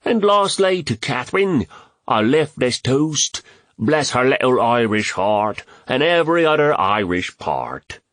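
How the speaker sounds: high, hammed-up Irish brogue; dreadful mock Irish accent. A narrator's reading. Joker toasts Catherine, in dreadful mock Irish accent. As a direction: toast in a badly faked Irish lilt. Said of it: "continued with a dreadful mock Irish accent".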